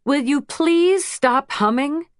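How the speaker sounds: slow, exasperated complaint; snapping at her friend to stop; irritated. A narrator's reading. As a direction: plead for silence, annoyed.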